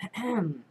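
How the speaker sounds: short pointed throat clear; demonstrated throat-clearing. Retold or imitated. Showing how they signalled annoyance to noisy diners, pointed throat-clearing. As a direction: clear your throat as a hint. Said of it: "you know, like this".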